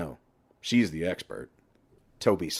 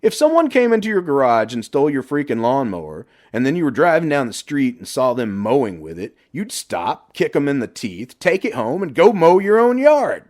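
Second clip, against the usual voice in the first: growing higher and louder to a big shout; building rant; 7 semitones higher, 8 dB louder than usual. Acted. Johnny argues with Toby, building to a loud shout.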